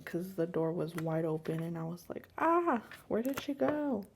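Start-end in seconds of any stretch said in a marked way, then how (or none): 2.4-4.0 high, mildly panicked mock cry; retold panic. Mother recalling she couldn't find her daughter, retold panic. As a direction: act out a worried yelp, pitched up.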